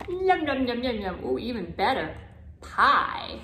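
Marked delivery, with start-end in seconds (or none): none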